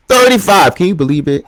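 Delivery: high, loud celebration; excited. Real, not acted. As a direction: celebrate the number. Celebrating the episode count.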